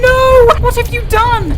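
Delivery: high loud anguished cry; horrified scream. Acted. Elizabeth seeing the girl fall, screaming in horror. Girl falls to her death.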